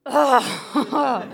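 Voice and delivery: exasperated groan that melts into a laugh; a defeated ugh, half laughing. Retold or imitated. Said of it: "And I just went".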